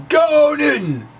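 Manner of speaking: high, loud, slow mock-sports cheer; root for Newton like a fan in the stands; cheering on Newton's theory like a sports fan; playful cheer.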